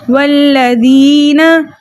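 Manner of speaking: वल्लज़ीना slowly recited, soft thin dhal and long ee. Shown as a demonstration. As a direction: say वल्लज़ीना with a gentle dh, stretching the ee. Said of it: "हमने कैसे पढ़ना है".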